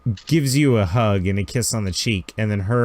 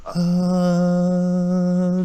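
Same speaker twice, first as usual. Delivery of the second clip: imitated engine hum; 6 semitones higher than usual.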